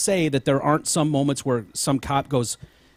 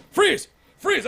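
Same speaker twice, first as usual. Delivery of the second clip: shouted command. Retold or imitated. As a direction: yell it like a panicked cop. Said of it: "this guy's like".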